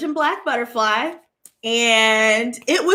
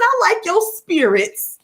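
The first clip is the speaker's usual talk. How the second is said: sweet praise; 7 semitones higher than usual. Retold or imitated. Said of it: "I said".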